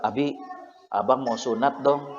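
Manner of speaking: low, softened request to a parent; a touch of sadness, voice kept low; subdued sad child. Retold or imitated. Son asking his father to be circumcised, mildly sad. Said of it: "Tapi kok ngomongnya kayak sedih".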